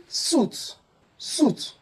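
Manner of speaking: suit as "soot" with long oo; mispronounced suit. Shown as a demonstration. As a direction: say suit with a plain oo vowel. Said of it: "it is wrong to say".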